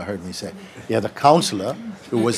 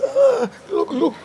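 pained king; slower than usual. Retold or imitated. King shows his hurt arm.

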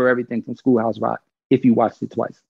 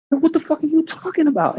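pitched way up in retold confusion; baffled disbelief. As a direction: throw your voice up high in total bafflement. Retelling his baffled reaction.

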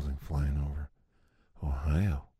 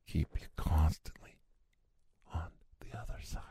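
whispered repeat. Shown as a demonstration.

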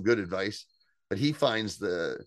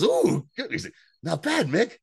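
high, pleasantly surprised self-praise; imitated delighted approval; 9 semitones higher, 3 dB louder than usual. Tasting Mick's ants on a log, high mock delight. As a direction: lift it high, mimicking a delighted taster. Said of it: "my favorite line delivery".

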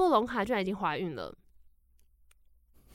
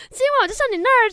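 much higher, louder and fast, a sleazy leer; hammed-up creepy suitor; 13 semitones higher, 10 dB louder, faster than usual. Stranger promising to visit Ibolyka tonight, hammed-up creepy leer. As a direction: overplay it as a gross creepy come-on. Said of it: "好恶心哦".

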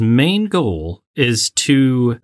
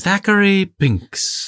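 put-on posh accent; 8 semitones higher, slower than usual. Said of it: "say it with that kind of accent".

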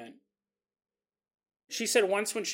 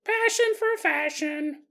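talking doll; 10 semitones higher, slower than usual. Pretend. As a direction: chirp it like a toy's catchphrase. Doll catchphrase voice.

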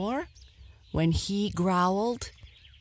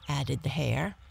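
usual speaking voice

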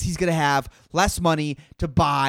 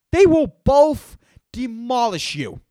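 loud, slow and higher; emphatic; 8 semitones higher, 5 dB louder, slower than usual. Acted. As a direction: hammer each word. Comic rant.

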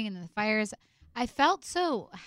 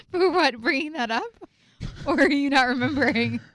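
louder, higher, speaking through real laughter; laughing; 5 semitones higher, 7 dB louder than usual. Real, not acted. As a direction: laugh your way through the protest. Laughing at a shared embarrassing memory.

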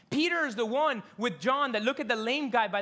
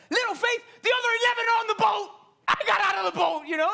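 high, loud, fast outraged protest; indignant rant; 13 semitones higher, 8 dB louder, faster than usual. Pretend. Pastor imagining Peter talking back to Jesus, indignant shout. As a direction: protest the unfair label, shouting. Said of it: "I would be like".

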